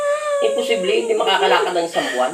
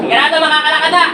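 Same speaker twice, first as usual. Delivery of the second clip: high, raised-voice check; forceful insistence; 6 semitones higher, 4 dB louder than usual. Real, not acted. Healer insists on the patient walking, high and forceful. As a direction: press for certainty, pitched up. Pressing that patient will walk.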